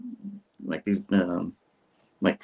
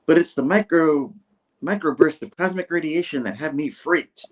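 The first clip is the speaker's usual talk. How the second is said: higher, emphatic character read; lightly voiced archaeologist; 6 semitones higher, 4 dB louder than usual. Archaeologist answering her assistant, lightly acted emphatic objection. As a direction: play the scientist a touch higher and firmer.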